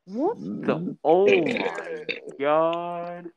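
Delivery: startled loud drawn-out. Real, not acted. Startled while gaming.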